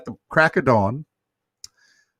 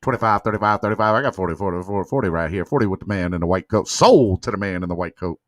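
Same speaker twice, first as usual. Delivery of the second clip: auctioneer; 6 semitones lower, faster than usual. Pretend. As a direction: lower fast auctioneer patter. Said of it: "I probably could be like a an auctioneer".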